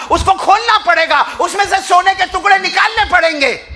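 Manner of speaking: high, loud impassioned preaching; fiery Urdu sermon storytelling; preaching at full force. Acted. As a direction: drive the story home at full cry.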